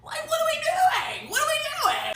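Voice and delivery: very high, strained cry of exasperation; exasperated wail. Real, not acted. Client fed up with the stylist's charges, exasperated wail.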